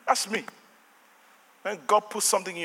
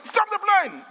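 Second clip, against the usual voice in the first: high, emphatic order; retold demand; 13 semitones higher, slower than usual. Passenger overriding airline staff, high insistent demand. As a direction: cut in with a high-pitched command. Retelling demand to halt plane.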